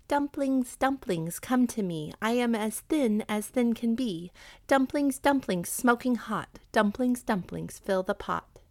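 rhythmic chant, starting high on each call; repeated incantation. A narrator's reading. Hungry widow summoning food from the kettle, chanting. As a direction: chant it like a magic rhyme. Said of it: "repeating these words again and again".

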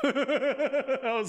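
words breaking into laughter; laughing. Real, not acted. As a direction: laugh through the words, high and helpless. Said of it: "'Cause you laughing was making me laugh".

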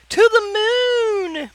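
very high, long-drawn joyful whoop; happy cry. A narrator's reading. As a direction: cry it out gleefully, stretching the last word. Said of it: "happily cried out".

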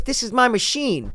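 put-on high, slow old lady voice. Old lady at the slots congratulating him, high and slow.

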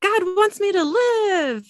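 high, loud cry of a man saved; joyful yell. Acted. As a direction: yell it out in high-pitched amazement, stretching the last words. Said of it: "He yells".

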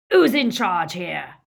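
loud roar. A narrator's reading. Greyback roars at the Snatchers to assert control, loud roar. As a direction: bellow over the gang. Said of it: "roared Greyback".

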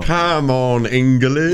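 loud, high hammed-up English supporter; British football fan. Supporter urging England on, loud mock British shout.

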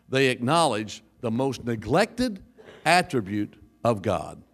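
usual speaking voice

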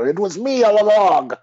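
high, hammed-up culprit confession; villain's reveal. Unmasked culprit confesses to the inspector, gloating theatrically. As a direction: crow it like a pantomime villain. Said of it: "our little mystery play".

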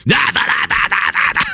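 high mock yelling in nonsense syllables; mock a bishop shouting back in gibberish; mock angry gibberish. Preacher imagining the bishop yelling at Zelensky, high mock gibberish. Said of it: "slamming his fist and yelling and screaming".

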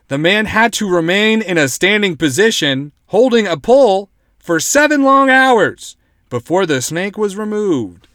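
hammed-up announcer stretching the key words; dramatic news read. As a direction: relish the ordeal as you report it. Reads article in news style.

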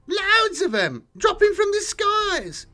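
very high, squeaky grot gushing news; panicked blurt. Acted. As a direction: spill it all out at once. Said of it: "it blurted".

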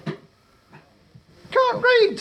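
very high, snivelling squeak; sniveling apologetic. Acted. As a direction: whimper it like a timid goblin. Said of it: "sniveled the apprentice sorcerer apologetically".

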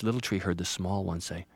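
plain reading voice

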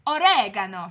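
loud "oregano", punching REG; emphatic parting shot, stress on the second syllable of "oregano"; defiant American oregano. Pretend.

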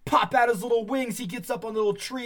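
ordinary delivery

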